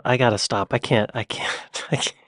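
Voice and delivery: ordinary talk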